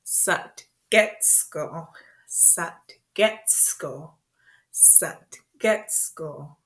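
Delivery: "Satgetsko" very slowly, syllable by syllable; slow repeat-after-me. Shown as a demonstration. Teacher leading learners through word and movement, slow and segmented. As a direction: space out "Satgetsko" as if timed to movements. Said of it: "do the movement with me".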